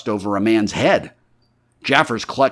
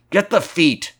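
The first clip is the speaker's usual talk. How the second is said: higher-pitched urgent order through the teeth; through clenched teeth; 10 semitones higher than usual. A narrator's reading. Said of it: "said Jaffers between his teeth".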